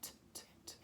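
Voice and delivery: "t" as bare, soft, voiceless taps; isolated letter sound. Shown as a demonstration. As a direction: repeat just the crisp "t" sound. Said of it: "that's a T".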